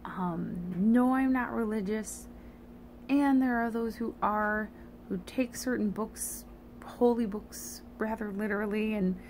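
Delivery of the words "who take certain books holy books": ordinary talk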